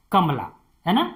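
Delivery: normal talking voice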